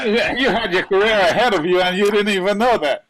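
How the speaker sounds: laughing loudly through the words. Real, not acted. Teasing friend while laughing.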